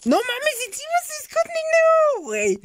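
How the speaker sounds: very high, strained outrage at the enemy; squealing disbelief. Real, not acted. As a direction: squeal at a cheap move by an opponent. (chillido agudo de incredulidad)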